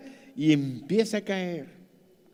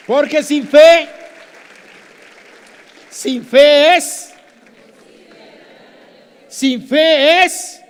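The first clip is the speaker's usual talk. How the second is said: loud, slow, high fervent scripture cry; preaching at full force; 6 semitones higher, 12 dB louder, slower than usual. Acted. Preacher proclaiming scripture to the church, full-force preaching. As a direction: thunder the verse at the congregation.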